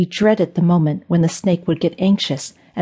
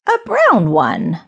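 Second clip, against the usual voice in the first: a slow, menacing witch voice; sinister witch; 4 semitones higher, slower than usual. A narrator's reading. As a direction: savor each word like a gloating witch.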